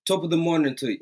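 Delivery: put-on playful Irish accent. Streamer greeting a viewer, playful Irish accent. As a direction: greet her in a light, put-on Irish lilt. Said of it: "No, that's Irish".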